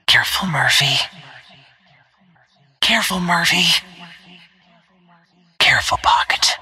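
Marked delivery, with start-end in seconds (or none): 2.8-3.6 higher-pitched heads-up; urgent warning. Acted. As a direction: caution a teammate with some alarm. Game warning to a teammate.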